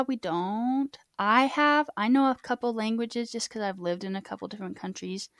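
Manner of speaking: normal talking voice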